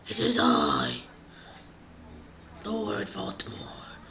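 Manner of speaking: very deep, slow villain announcement; Lord Voldemort. Acted. Villain announces himself at the giant door, deep slow voice. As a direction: announce yourself grandly in a low villain voice. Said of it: "And he goes".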